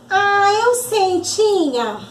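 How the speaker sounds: put-on drawn-out, high little-kid answer; lightly voiced keen pupil; eager child puppet. Arabella knows the answer for auntie, eager child.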